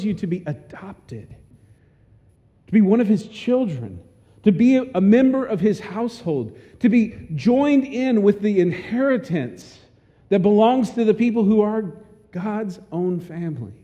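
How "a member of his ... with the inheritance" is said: ordinary talk, nothing marked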